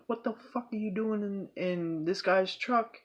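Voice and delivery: high-pitched confronting neighbor; retold challenge, high but not shouted; imitating alarmed neighbor. Voices neighbor confronting the intruder.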